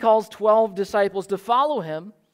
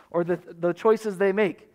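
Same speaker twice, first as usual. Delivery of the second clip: ordinary delivery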